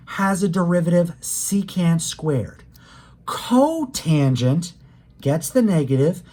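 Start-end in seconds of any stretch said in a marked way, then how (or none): 3.3-4.7 "Cotangent" with CO drawn out slowly; stress on CO. Shown as a demonstration. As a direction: stretch and lean on the "Co" in "Cotangent". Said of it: "I was just emphasizing the co".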